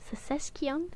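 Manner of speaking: "Secession" said as "se-sesk-ion", rising like a question; "Secession" queried with an added "kion" ending. Shown as a demonstration. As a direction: try "Secession" with a hard k before "ion". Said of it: "Where you getting the Kion from?".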